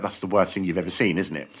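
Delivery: low, soft sarcastic aside; eye-rolling aside. Retold or imitated. Compere mocks the act to the crowd in a low, soft aside. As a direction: mutter it to the crowd with an eye-roll. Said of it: "rolling his eyes".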